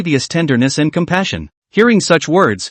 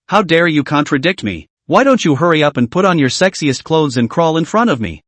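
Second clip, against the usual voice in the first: plain reading voice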